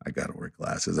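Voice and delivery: low, quiet voice of a laid-back friend; Philip. Retold or imitated. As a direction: imitate him talking low and soft. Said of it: "and this is how he talks".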